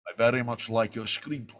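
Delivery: low, measured Scottish star impression; Sean Connery. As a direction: imitate a grand actor, deep and deliberate. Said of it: "you do do a damn good".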